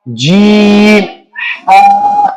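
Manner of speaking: long, level-held letters, one at a time; letter recitation drill. Shown as a demonstration. Teacher modelling repeated practice for students, drawn-out recitation. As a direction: draw each letter out on a steady note.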